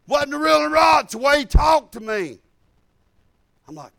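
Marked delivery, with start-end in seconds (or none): 0.1-2.4 loud heated retort; angry shout. Retold or imitated. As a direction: fire it back, still offended. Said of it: "he was really mad".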